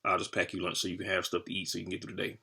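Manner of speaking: lightly voiced mom, low and soft; a hint of a caring mother, low and quiet; mom's voice. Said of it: "My mom was like".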